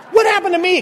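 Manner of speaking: high, loud hypochondriac alarm; hammed-up fright; panicked question. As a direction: yelp it in mock panic.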